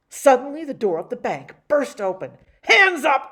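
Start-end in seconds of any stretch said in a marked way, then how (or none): 2.6-3.3 high, loud robber's bark; rough shout. Acted. Bank robber bursts through the door, loud rough shout. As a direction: burst in and take the room. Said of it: "cried a rough voice".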